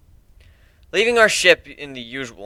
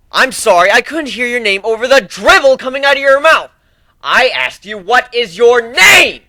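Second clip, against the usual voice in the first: loud, higher barked demand; drill instructor; 10 semitones higher, 9 dB louder than usual. Acted. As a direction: bark it like a drill sergeant. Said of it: "I'm not your drill instructor".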